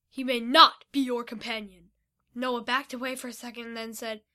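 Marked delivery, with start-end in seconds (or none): 0.1-1.9 loud, forbidding order; yelled refusal. A narrator's reading. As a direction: forbid it firmly, raising the volume. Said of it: "Vito yelled".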